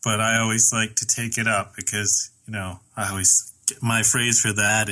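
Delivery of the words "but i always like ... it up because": usual speaking voice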